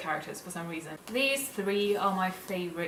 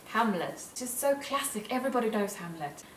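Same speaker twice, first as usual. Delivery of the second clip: usual speaking voice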